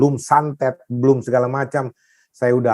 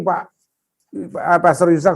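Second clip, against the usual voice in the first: normal talking voice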